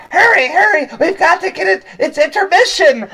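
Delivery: high, loud, squeaky panicked kobold; frantic kobold cooks. Acted. Kobold cooks shouting to each other in a panic, high and loud. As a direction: squeal it like a rushed little kitchen goblin. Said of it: "They go, they go".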